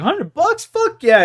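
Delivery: high, loud mock glee at the cash; thrilled buddy. Imitating his buddy jumping at a hundred bucks, high and loud. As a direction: jump at the money like a greedy kid.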